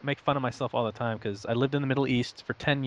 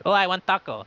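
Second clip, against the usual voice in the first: hammed-up Russian accent, higher and louder; playful fake Russian accent, pitched up; mock Russian accent; 8 semitones higher, 5 dB louder than usual. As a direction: play a jokey Russian accent ordering taco. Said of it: "I talk like Russian accent".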